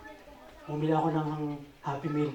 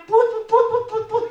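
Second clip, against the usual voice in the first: horn imitation; 9 dB louder, slower than usual.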